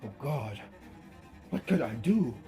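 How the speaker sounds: ordinary delivery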